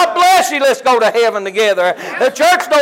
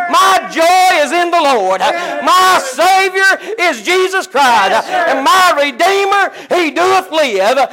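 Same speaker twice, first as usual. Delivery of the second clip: full-force preaching; 4 semitones higher than usual. Acted. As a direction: lift the congregation with a shouted gospel call. Preaching builds to full shout.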